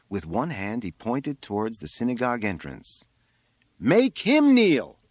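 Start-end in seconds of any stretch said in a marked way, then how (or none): 3.8-5.0 high, loud, drawn-out yell; shouted command. A narrator's reading. Boy on the roof pointing at the entrance, shouted command. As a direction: yell it slowly, each word held.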